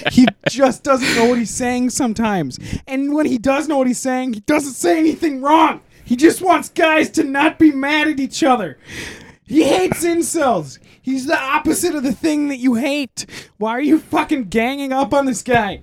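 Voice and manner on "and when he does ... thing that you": heated shouting. Real, not acted. Defending Jordan Peterson, ranting.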